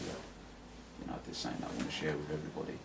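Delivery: quiet, gentle invitation to share. Shown as a demonstration. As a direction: bring them in gently. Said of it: "just bring people in gently and just say".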